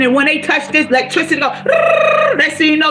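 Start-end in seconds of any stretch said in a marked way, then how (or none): 1.5-2.3 burst of laughter. Real, not acted. Laughs mid motivational talk.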